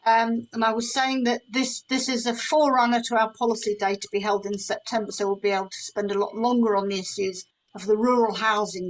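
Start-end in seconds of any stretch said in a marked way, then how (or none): none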